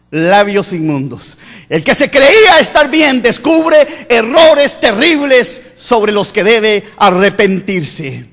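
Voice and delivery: ordinary talk, nothing marked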